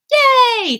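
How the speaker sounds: very high, loud yay; excited cheer. Real, not acted. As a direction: cheer out loud for a new subscriber. Cheers a new subscriber.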